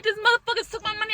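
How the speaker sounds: retold outburst. Discovering the stolen rent money.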